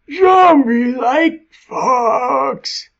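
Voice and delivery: high, dragged-out groaning monster voice; zombie voice. Pretend. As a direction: moan it slowly like a hungry undead. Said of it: "do a zombie voice".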